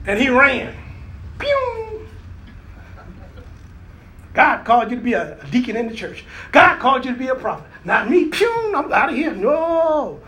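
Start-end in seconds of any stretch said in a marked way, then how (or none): 1.4-2.1 whoosh sound. Acted. Jonah fleeing, whoosh noise. 8.3-8.7 whoosh sound. Acted. Jonah runs off again.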